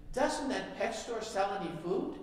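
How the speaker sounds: lightly voiced parrot, drawn out; parrot's last words. Dying parrot's punchline in joke.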